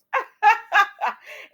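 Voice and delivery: real laughter breaking out after the joke; loud laugh. Real, not acted. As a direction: burst into a high, loud laugh. Laughs after her own line.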